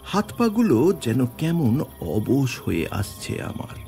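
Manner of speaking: ordinary delivery, nothing marked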